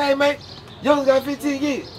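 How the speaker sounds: broadcast it to the whole unit; calling out. Retold or imitated. Inmate announcing it to everyone.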